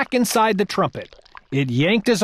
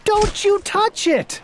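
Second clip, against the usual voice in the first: shouted warning; 13 semitones higher, slower than usual. Acted. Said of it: "shouted Bartholomew".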